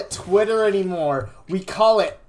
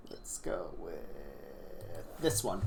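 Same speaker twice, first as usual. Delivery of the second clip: slow, soft whisper; 14 semitones lower, 15 dB quieter, slower than usual. Acted. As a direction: breathe it out secretively. Hushed card pick.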